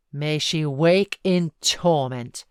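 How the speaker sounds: loud, slow, furious curse; cried with vehemence. A narrator's reading. Heathcliff curses his dead love, loud and slow. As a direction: spit the curse out with frightful vehemence. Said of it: "he cried with frightful vehemence".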